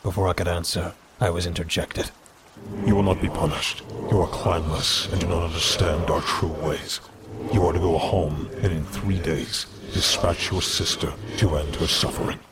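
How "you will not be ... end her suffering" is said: cold, commanding judge; stern council elder. Acted. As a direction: pronounce the sentence coldly. Council member's verdict.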